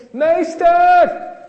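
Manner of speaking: very high, very loud drawn-out yell; full shout. Shown as a demonstration. Teacher showing children what shouting is, full-voiced yell. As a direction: scream it to show what shouting is. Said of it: "weet je wat schreeuwen is?".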